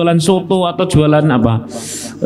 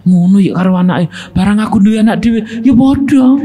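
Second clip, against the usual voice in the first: rising, louder and higher until it breaks into a laugh; mock scolding; 6 semitones higher, 3 dB louder than usual. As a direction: scold like a fed-up parent, then laugh. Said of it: "orang tua lagi marah sama anak". (omelan orang tua yang makin tinggi lalu tertawa)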